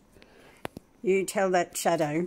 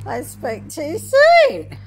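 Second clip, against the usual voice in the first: high, loud, slow words through real laughter; laughing hard; 11 semitones higher, 14 dB louder, slower than usual. Real, not acted. Caught off guard by the dog, laughing hard. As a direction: break up laughing mid-line.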